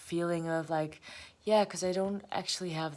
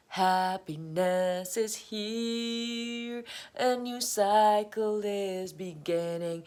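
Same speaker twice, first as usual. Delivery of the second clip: drawn-out, higher mock-joyful announcement; grand proclamation; 4 semitones higher, 4 dB louder, slower than usual. Tarot card promising her bliss, grandly drawn out. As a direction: proclaim it slowly, stretching out the words.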